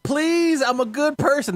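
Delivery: high whiny begging, hammed up; mock pleading. Narrator to friends, begging the cops: high mock pleading. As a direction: plead pitifully for mercy.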